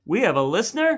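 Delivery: playfully shocked question; mock surprise. Real, not acted. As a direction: act stunned someone is listening. Amazed that anyone listens.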